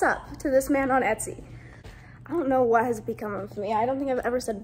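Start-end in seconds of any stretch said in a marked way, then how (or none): none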